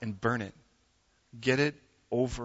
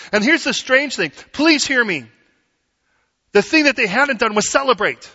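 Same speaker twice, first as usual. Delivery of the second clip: louder, higher preacher's drive; preaching at full force; 8 semitones higher, 9 dB louder than usual. Acted. A pastor urging his church to celebrate, in full preaching force. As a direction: press it home to the congregation.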